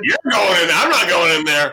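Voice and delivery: high, put-on frightened child voice; refusing to go wake dad; scared kid. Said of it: "like the grumpy dad taking a nap".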